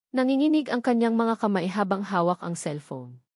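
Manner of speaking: ordinary delivery, nothing marked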